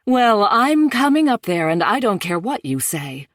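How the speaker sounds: a mother who won't be talked out of it; insistent mom. A narrator's reading.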